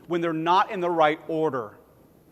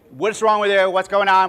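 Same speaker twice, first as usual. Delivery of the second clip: mock scold, louder; lightly voiced judgy guy snapping; 3 semitones higher, 7 dB louder than usual. Judgy churchgoers confronting a despairing person, scolding.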